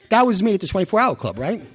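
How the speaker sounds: ordinary talk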